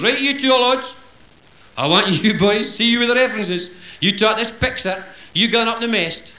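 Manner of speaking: a lightly voiced skipper commanding his crew; skipper's orders. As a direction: play the gruff ship's captain.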